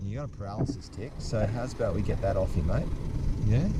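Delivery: hushed, gentle, calm; soft reassuring voice. Real, not acted. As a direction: talk softly to settle the animal. Quietly soothing the lizard.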